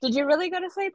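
high mocking sarcastic question; mocking disbelief. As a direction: scoff at his excuse, pitch jumping way up. Mocking the ex's excuse.

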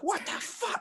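high, shocked mock teacher. Substitute teacher seeing the donut boys return, shocked. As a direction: sound baffled and appalled.

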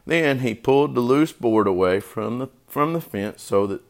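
usual speaking voice

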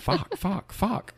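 high mock Maine "Fuck" for fork; Maine accent. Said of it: "that's how it".

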